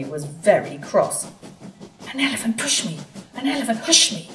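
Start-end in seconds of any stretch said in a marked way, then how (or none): 2.1-3.0 indignant hiss. Acted. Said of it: "he hissed". 3.3-4.3 indignant hiss. Acted. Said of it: "he hissed".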